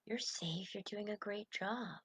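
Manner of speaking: soft, slow reassurance to oneself; soothing self-talk. Acted. Coach voicing kind self-talk, soft and reassuring. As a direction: comfort your scared thought softly. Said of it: "I can tell my thought".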